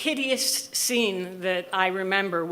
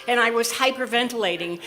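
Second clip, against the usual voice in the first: ordinary delivery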